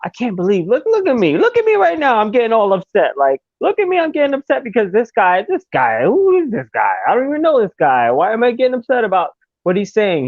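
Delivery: high, mock-exasperated inner voice; talking to himself, amazed at how upset he's getting; incredulous self-talk. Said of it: "I'm like".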